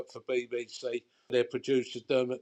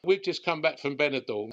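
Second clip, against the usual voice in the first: ordinary delivery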